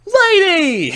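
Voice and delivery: high, loud mock cry; mock calling out. Acting out the discovery of Lady, mock calling out. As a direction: call the name out high and loud, hammed-up.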